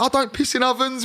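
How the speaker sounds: high-pitched retold protest; acted denial. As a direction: play the mate insisting he's innocent. Acts out mate's denial.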